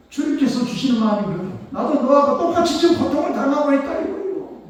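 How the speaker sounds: loud, high, impassioned sermon climax; full-force preaching. Acted. Preacher proclaiming God shares his suffering: loud impassioned preaching. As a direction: preach it out with fire.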